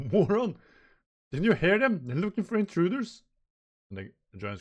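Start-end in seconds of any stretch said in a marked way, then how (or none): 0.1-3.2 higher, louder angry scolding; Nami scolding. Acted. Nami angrily scolding Luffy, higher and louder. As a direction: snap at him for being careless. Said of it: "And Nami going".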